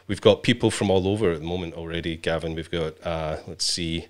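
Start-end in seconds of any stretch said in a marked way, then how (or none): none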